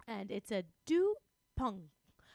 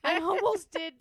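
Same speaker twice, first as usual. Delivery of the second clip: laughing; 7 semitones higher, 11 dB louder than usual. Real, not acted. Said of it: "do it with a French accent now".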